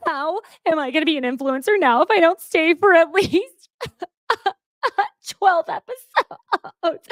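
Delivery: hammed-up sob about influencer plans; mock sobbing. Andrea sobbing after being voted off, mock wail. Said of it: "the way she was sobbing".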